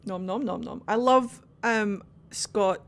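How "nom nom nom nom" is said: quick nom-nom noises; munch out the noms playfully; imitated munching. Gamer making idle noises during play, imitated munching.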